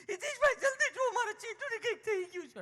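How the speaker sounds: mock a frantic city mother; panicked mother. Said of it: "ચાર વર્ષનો છોકરો ... લઈને આવ્યા". (ગભરાયેલી મમ્મીની ઊંચા અવાજે નકલ)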